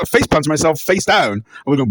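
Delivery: normal talking voice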